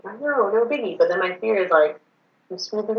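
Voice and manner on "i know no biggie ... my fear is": ordinary talk, nothing marked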